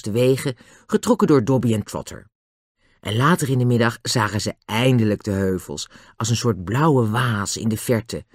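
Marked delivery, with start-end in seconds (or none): none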